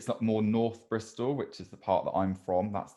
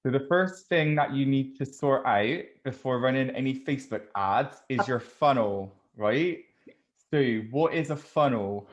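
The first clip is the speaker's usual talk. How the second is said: a thick put-on Bristolian accent, a little louder; broad Bristolian, played straight to explain funnels; hamming up a Bristol accent with a straight face; 4 semitones higher, 4 dB louder than usual. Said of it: "Shall I do the whole podcast in the Bristolian accent?".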